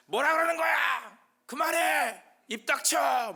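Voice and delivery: high, full-force heckling yells; angry shouting. Acted. Sadducees drowning out talk they hate, shouting angrily. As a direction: shout them down in short bursts. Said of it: "막 소리를 지릅니다".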